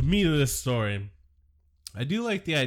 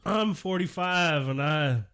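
higher, put-on dopey voice; mock Musk; 4 semitones higher, 5 dB louder than usual. Host mocks Musk bragging about the Rogan podcast. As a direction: brag like a clueless middle-aged guy.

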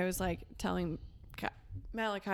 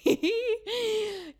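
laughing; 13 semitones higher, 8 dB louder than usual. Real, not acted. Laughing fit.

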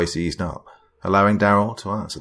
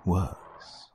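softer menacing warning; quiet warning; 8 dB quieter than usual. Acted. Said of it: "the voice warned".

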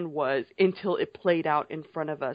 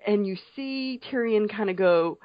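usual speaking voice

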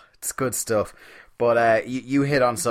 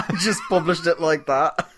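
higher, through real laughter; laughing; 7 semitones higher, 4 dB louder than usual. Real, not acted. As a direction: laugh your way through the words. Amused by the publisher giving in.

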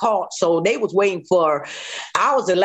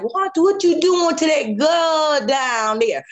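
put-on old man's call; 8 semitones higher, slower than usual. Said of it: "he said, he like".

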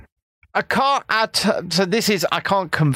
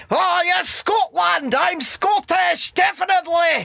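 mock Scottish shouting; 12 semitones higher than usual. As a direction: shout it like an over-proud Scot, stretching Scottish. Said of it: "he puts the accents on".

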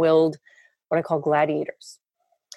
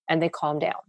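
usual speaking voice

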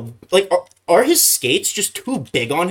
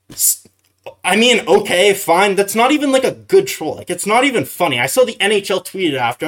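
ordinary talk, nothing marked

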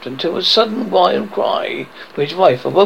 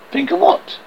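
puzzled question; 10 semitones higher than usual. A narrator's reading. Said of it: "he questioned".

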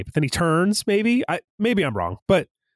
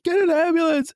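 high-pitched imitated call for help; mock urgent cry; 14 semitones higher than usual. Retelling him calling for help as she dies, high mock cry. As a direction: cry out as the frantic bystander, retold.

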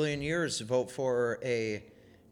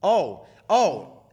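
sarcastic; 4 semitones higher, 7 dB louder than usual. Pretend. Mocks the apology excuse loudly.

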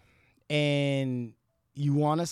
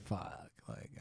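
much softer, whispered inner curse; whispered thought; 7 semitones lower, 15 dB quieter than usual. Retold or imitated. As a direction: breathe it out like a private thought. Imagined inner thought of dismay.

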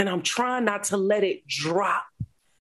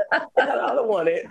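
laughing; 5 semitones higher than usual. Real, not acted. Turning down God's gift to the host, laughing. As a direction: laugh while you refuse it.

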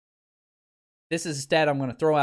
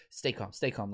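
soft, low self-soothing murmur; mock self-calming; 6 semitones lower, 9 dB quieter than usual. Quarterback mocked for calming himself, softly murmured. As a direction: talk yourself down under your breath. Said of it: "you can see he's like".

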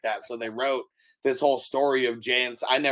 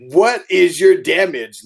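mock indignant; 4 semitones higher, 12 dB louder than usual.

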